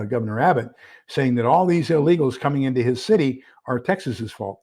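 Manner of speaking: normal talking voice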